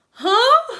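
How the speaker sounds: high startled huh with a laugh; a disbelieving yelp of a huh; shocked huh. Retold or imitated. Stunned by her client's confession, a high shocked huh. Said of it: "we was all like".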